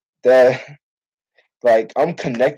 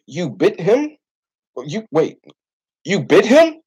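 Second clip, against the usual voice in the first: incredulous rising question, much higher on the repeat; a coach who can't believe what he reads; disbelief; 7 semitones higher, slower than usual. Retold or imitated.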